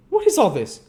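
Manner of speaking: loud, high involuntary outburst; startled cry. A narrator's reading. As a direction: blurt it out in alarm. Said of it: "cried the girl involuntarily".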